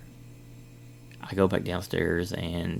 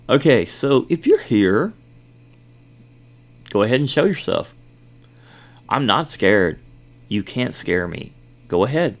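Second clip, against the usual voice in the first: loud, raised-pitch dare; bold challenge; 4 semitones higher, 8 dB louder than usual. Retold or imitated. Guard challenging the ghost to show itself, boldly. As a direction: call out firmly, daring it to appear. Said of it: "this is kind of my comment".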